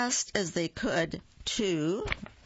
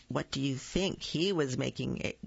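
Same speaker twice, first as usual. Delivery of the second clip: usual speaking voice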